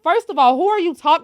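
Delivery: loud, high indignant retort; confrontational comeback. Pretend. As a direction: pretend to square up to the cashier. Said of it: "I'm a little confrontational".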